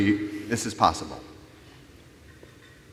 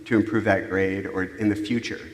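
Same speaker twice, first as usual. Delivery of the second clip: gentle parent; 7 semitones lower, 6 dB quieter than usual. Shown as a demonstration. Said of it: "then you can say".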